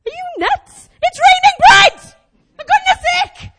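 high, loud, incredulous shriek; outraged yelling. Acted. Preacher berating Israelites who want slavery for meat, outraged. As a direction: yell it in exasperated disbelief, very high.

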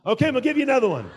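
fast, loud, laughing through it. Real, not acted. Preacher laughing as he starts another story, fast and loud. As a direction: rattle it off while laughing.

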